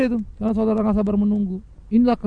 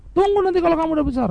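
quicker, higher mock parental reply; lightly acted parent, raised pitch and quick; parent putting off a child; 7 semitones higher, faster than usual. Parent telling a child to wait for the knife, lightly impatient.

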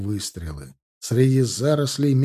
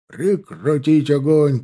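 loud, high, drawn-out order; shouted command; 4 semitones higher, 6 dB louder, slower than usual. A narrator's reading. Salov halting the riflemen's fire, shouted command. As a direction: stretch out the cease-fire call. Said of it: "крикнул Салов".

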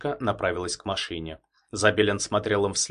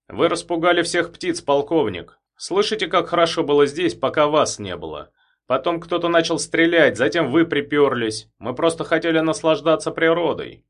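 indignant reproach; 7 semitones higher, 6 dB louder than usual. Acted. Trifonov scolds the colonel.

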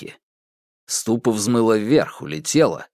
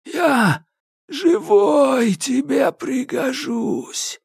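high, slow creaky plea; Koshchei bargaining; much slower than usual. A narrator's reading. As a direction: wheedle for your life. (Кощей умоляет)

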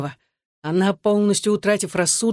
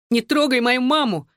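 squealing; 7 semitones higher than usual. A narrator's reading. Son shields his mother from his father, squealing. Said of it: "визжал он".

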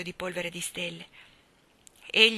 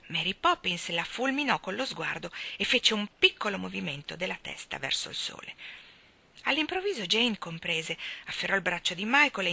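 usual speaking voice

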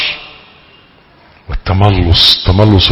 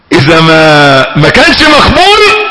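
preacher's outcry; 3 semitones higher, 5 dB louder than usual. Real, not acted. Preacher's emphatic warning.

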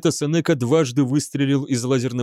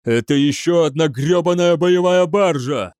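louder, higher angry cry; exploding outburst; 5 semitones higher, 5 dB louder than usual. A narrator's reading. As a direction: burst out with the curse. Same words repeated as an outburst. (взрывается криком)

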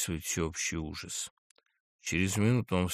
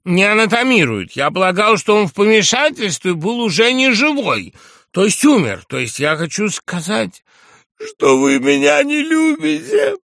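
put-on high, loud, overwrought drunken speech; distraught loud plea; 11 semitones higher, 12 dB louder than usual. A narrator's reading. Drunk general protests to his guests that he loves them, distraught loud plea. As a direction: declaim high and loud, slowing on key words.

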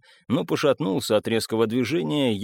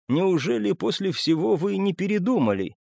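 high-pitched incredulous question; distressed disbelief; 8 semitones higher than usual. A narrator's reading. Bessik doubts the narrator still wants him, incredulous. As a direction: ask in pained disbelief, pitched up.

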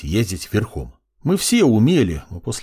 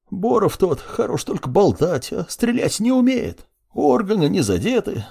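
higher folksy voice, brushing off pain; tough old servant woman; 5 semitones higher than usual. Acted. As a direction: play a gruff wounded nanny.

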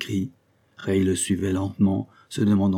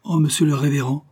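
higher and louder, an alarmed cry; dismayed; 7 semitones higher, 5 dB louder than usual. Acted. Servant Kezia dismayed at the vicar's order, a higher, louder cry. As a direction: gasp it out in shocked dismay. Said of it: "dit Kezia consternée".

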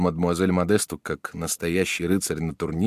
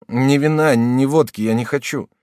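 short, clipped refusal, louder; gruff brush-off, a touch louder; curt answer; 7 semitones higher, 7 dB louder than usual. A narrator's reading. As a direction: bite off each word. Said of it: "отрывисто ответил Жак".

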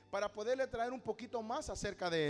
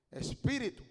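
commanding exorcist; 4 semitones lower, 6 dB louder, slower than usual. Retold or imitated. Casting out a demon.

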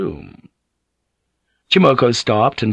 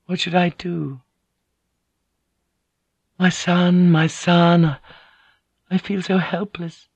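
higher-pitched, grieving inner plea; acted helpless lament in a higher register; helpless lady; 6 semitones higher than usual. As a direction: voice a lady at a loss.

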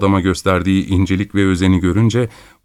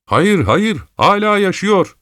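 jumps in much higher, urgent; urgent interjection; 10 semitones higher, slower than usual. A narrator's reading. A bystander breaks in to say the man still lives, urgent and high. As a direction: another bystander cuts in insisting he lives. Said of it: "diye atıldı bir diğeri".

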